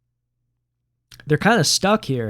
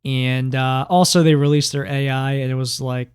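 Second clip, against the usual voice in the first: ordinary talk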